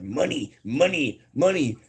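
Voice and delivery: high, swooping cartoon chant; each word pops up high like a catchphrase; Mr. Krabs. Streamer showing chat the crab meme, high cartoon chant. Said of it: "that Mister Krabs meme where it's like".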